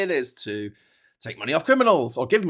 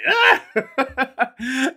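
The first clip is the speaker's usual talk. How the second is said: high, loud gleeful yell; hammed-up delighted agreement; mock eager yes; 13 semitones higher, 7 dB louder than usual. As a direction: squeal it out with a laugh. Acts out his eager happy reply.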